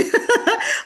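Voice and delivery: a high, bright burst of laughter; short real laugh. Real, not acted. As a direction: crack up mid-thought. Laughs mid-sentence.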